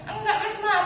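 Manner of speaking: put-on high, whiny tearful child voice; Bilkis crying. Little daughter protesting to her mother about Quran reading, tearful high whine. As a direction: whimper it like a little girl close to sobbing. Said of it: "Langsung nangis".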